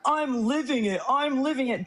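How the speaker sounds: high mocking parrot of him; mocking whiny imitation. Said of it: "you just told Parker".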